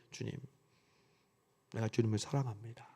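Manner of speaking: a quiet, downcast admission of love; dejected voice. Acted. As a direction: say it like a man who has lost heart. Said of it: "풀이 죽은 목소리로 얘기합니다".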